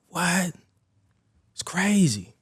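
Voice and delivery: high, airy retold shock; stunned disbelief. Teen reading girlfriend's pregnancy text, hushed high disbelief. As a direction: breathe it out high, almost whispered.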